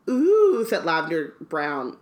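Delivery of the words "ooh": high, loud squeal of "ooh". A narrator's reading. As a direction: squeal a drawn-out ooh, pitched way up. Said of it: "squealed Lavender Brown".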